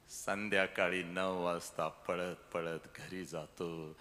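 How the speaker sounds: low, slow and soft; a mournful hush about the weeping father; slow, grieving narration. Acted. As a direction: sink into quiet grief. Father weeping alone at night.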